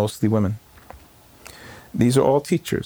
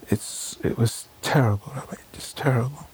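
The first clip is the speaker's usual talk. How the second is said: put-on breathy whisper, like a scared child; whispered child's voice; 4 semitones higher, 4 dB quieter than usual. Congregant confiding in her rabbi, whispering like a frightened child. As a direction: whisper it as a shaken little girl would. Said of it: "she says in a child's voice".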